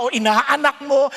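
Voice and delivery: ordinary delivery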